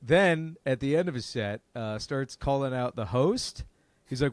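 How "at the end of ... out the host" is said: usual speaking voice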